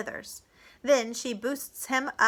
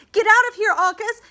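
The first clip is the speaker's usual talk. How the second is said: high, loud warning shout; urgent yell; 12 semitones higher, 11 dB louder than usual. A narrator's reading. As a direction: yell for him to flee. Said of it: "she yells".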